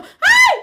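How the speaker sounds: sudden high scream of fright; startled yelp. Real, not acted. Startled by the story, a high shriek. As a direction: shriek as if caught off guard. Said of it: "Me asusté".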